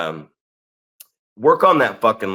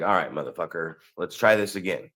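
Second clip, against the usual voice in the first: muttered to self; 7 semitones lower, 7 dB quieter than usual. Retold or imitated. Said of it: "So I was like".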